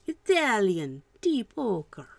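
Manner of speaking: hammed-up Italian accent, loud and swooping; bad Italian accent. Painter announcing a paint colour to viewers, bad Italian accent. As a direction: put on a terrible mock Italian accent. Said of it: "Worst Italian accent".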